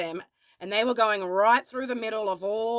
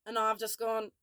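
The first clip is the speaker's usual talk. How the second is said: ordinary delivery, nothing marked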